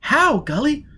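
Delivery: put-on high, urgent young woman's voice; Jiz's high voice. Jiz calling Foyle over the earphones, high and agitated. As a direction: pitch way up and press with worry.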